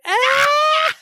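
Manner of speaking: high-pitched mock shriek, loud; mock scream. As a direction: let out a sudden squeal. Imitating her yelling out.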